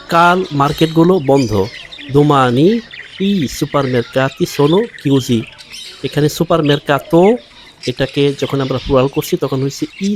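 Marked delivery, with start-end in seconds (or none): 2.1-5.4 very slow, each word laid out clearly; slow teacher demo; slow model reading. Shown as a demonstration. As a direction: spell the sentence out slowly for learners. Teacher models Italian sentence.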